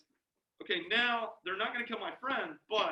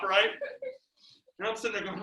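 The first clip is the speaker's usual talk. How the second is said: ordinary delivery, nothing marked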